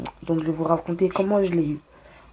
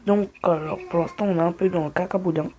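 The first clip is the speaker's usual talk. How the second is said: ordinary talk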